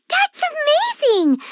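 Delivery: high, bright burst of amazement; amazed. Acted. Paimon amazed that the traveler reads stars. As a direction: gush with wonder at the news. Paimon impressed by star reading.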